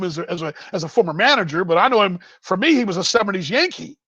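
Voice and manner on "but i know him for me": ordinary delivery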